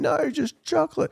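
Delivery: put-on high childish demand; toddler screaming. Dad imitating his son's demand for chocolate, a high childish cry. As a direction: insist like a stubborn toddler.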